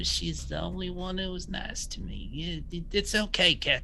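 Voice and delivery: high, dreamy coaxing voice; entranced Bull. Acted. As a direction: lure your friend along in a daze. Bull entranced, coaxing friend to ghost.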